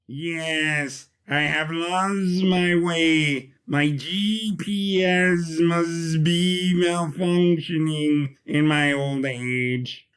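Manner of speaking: slow, creaky machine playing dumb; old robot Sidkick, feigning. Sidkick pretends to be lost to Arch I E, slow innocent robot. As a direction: drag it out like a senile bot.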